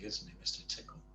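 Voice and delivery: usual speaking voice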